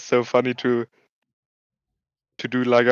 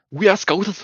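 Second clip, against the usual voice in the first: high, cocky echo of the fighter's boast; quoting the fighter's catchphrase for a laugh; mock Scouse brag; 7 semitones higher than usual. Streamer echoing the Liverpool fighter's boast, hammed-up Scouse brag. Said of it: "We don't get knocked out".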